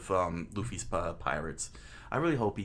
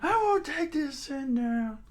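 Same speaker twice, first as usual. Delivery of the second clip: put-on high, strained old-man yell; hammed-up furious mayor; defiant old mayor, outraged; slower than usual. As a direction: cry out in strained indignation.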